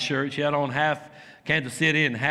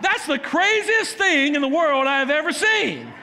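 high, slow astonished exclamation; stunned fan in the stands; amazed disbelief; 10 semitones higher, 3 dB louder than usual. Retold or imitated. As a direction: marvel at what you just saw. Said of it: "I'm like".

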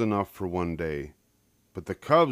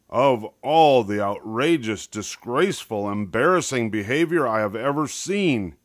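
angry roar; 4 semitones higher, 5 dB louder than usual. A narrator's reading. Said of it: "he roared".

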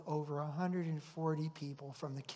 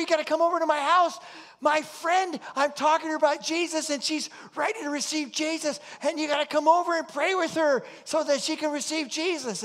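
much higher and louder, pressing and fast; excited urgent caller; 11 semitones higher, 12 dB louder than usual. Retold or imitated. Church member urging the pastor to come pray, high and loud. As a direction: beg him to hurry over, breathless with excitement. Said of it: "somebody phoned up and said".

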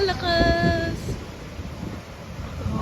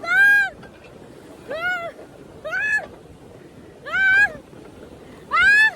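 real frightened screams; 13 semitones higher, slower than usual. Real, not acted. Rider pulled along over the waves, screaming. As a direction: shriek at each jolt.

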